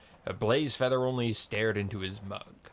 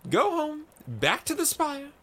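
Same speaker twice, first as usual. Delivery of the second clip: high, loud cry; cried out; 4 dB louder than usual. A narrator's reading. Myco crying at Blazefeather to go home, loud high cry. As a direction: fling it out at him, much higher and louder. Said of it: "cried Myco".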